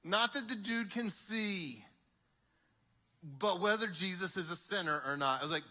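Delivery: ordinary talk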